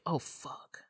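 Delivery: breathy whispered dread; a whispered curse while watching a horror film; whispered oh fuck. Retold or imitated. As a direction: mutter it under your breath in horror. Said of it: "you know, you're like".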